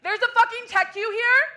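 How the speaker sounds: loud, high mock outburst; staged shout at the tech queue; fake yell. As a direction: yell it as theater. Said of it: "That was also fake when I yelled".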